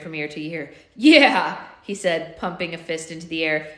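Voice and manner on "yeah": loud cheer; loud pumped-up yeah; triumphant yeah. A narrator's reading. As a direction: punch the air with it. Hero cheers, fist in air.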